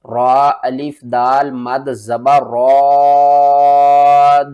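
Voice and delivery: teacher demonstrating madd lazim; long madd demo. Shown as a demonstration. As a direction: hold the madd on را, drawn out slowly. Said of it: "ہجے کر کے سمجھاتا ہوں".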